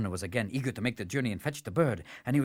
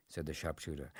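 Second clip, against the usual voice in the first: plain reading voice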